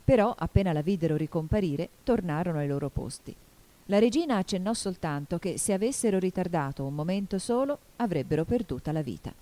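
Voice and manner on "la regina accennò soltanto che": ordinary delivery, nothing marked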